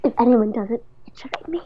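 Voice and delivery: acted, partly voiced whisper. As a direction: object in a hushed voice. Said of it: "this is all in whisper".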